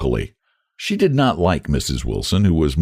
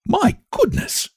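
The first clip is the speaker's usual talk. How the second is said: shocked. A narrator's reading. Lottie gasps in shock.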